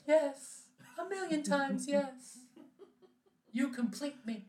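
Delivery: high, swooning and breathless with joy; gushing bride-to-be. Pretend. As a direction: gush like an overjoyed fiancée. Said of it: "She's like".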